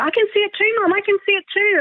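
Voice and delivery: put-on high, thrilled child's voice; eagerly backing up her sister; excited child. Eldest daughter telling mum she sees it, high and thrilled.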